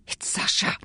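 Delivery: breathless whispered gasp. A narrator's reading. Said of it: "gasped the body".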